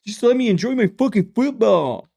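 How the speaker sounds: high, whiny fan voice; mock fan comment. Reading fan comments aloud, high whiny mock voice. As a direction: whine like a defensive football bro. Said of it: "I've literally read these comments".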